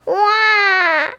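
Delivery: put-on high, loud baby crying wail; a baby bursting into a loud wail. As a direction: cry out like a wailing baby. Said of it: "he heard a strange noise".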